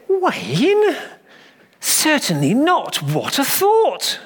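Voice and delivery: high, slow, scandalised vicar; mock horror. A narrator's reading. Vicar reacting to the boy's answer, mock horror. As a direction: gasp it in pretend shock. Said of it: "cried the vicar in mock horror".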